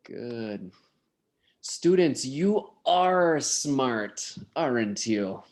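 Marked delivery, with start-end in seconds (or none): 1.6-5.4 ARE drawn out and stressed; slow, heavy emphasis on "are", then a falling "aren't you"; emphatic. Shown as a demonstration. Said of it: "With emphasis".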